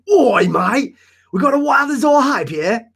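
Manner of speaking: a clumsy mock Australian; over-the-top fake Aussie accent; bad Australian accent. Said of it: "That accent was horrific".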